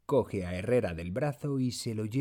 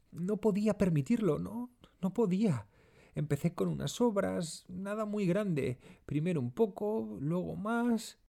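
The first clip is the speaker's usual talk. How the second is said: high-pitched moaning confession; Cristina; 9 semitones higher than usual. A narrator's reading. Cristina confesses to the detective about stolen food, moaning. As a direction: wail it out in a high voice, in quick bursts. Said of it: "Cristina gime".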